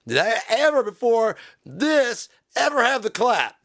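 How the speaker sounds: high, emphatic demand; outraged questioning. Pretend. Musician defending his record, mock outraged. Mock rant over a clap sound.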